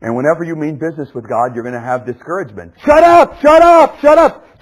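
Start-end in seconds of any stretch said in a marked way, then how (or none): 2.8-4.6 high, very loud repeated yell; silencing shout. Acted. Voices discouraging someone who seeks God, silencing shout. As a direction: shout them down, high and forceful.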